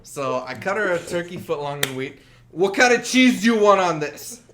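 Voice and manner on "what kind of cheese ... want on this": loud, higher, mock-impatient demand; impatient questioning. Sandwich maker asking about cheese, loudly impatient. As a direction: fire the question at the customer. Acted impatient counter worker.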